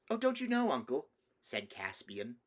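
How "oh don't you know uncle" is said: put-on high, louder child's exclamation; small boy bursting to explain; eager little boy. Nephew surprised his uncle doesn't know, eager little boy.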